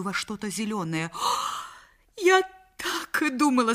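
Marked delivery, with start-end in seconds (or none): 1.2-1.7 sharp audible intake of breath; shocked gasp. Acted. Wife bursts in on the lovers. 2.2-3.7 high, louder outcry; crying out. Acted. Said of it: "закричала она".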